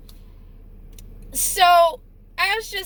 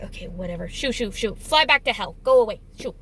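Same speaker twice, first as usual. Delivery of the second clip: dismissive brush-off; 6 semitones lower than usual. Retold or imitated. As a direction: wave him off under your breath, fed up. Dismissing complaining customer.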